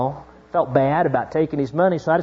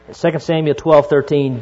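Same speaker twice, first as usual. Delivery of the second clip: normal talking voice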